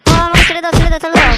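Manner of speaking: high, loud panicked begging; frightened pleading. Acted. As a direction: beg him to stop hitting you. Son begs while father beats.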